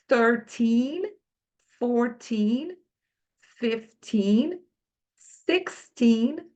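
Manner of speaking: put-on slow clear English teen numbers; each number drawn out with a rising tune; teacher modelling numbers. Shown as a demonstration. Teacher drills the teen numbers for Brazilian learners, slow and clear. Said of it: "Olha lá".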